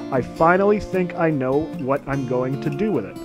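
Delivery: ordinary delivery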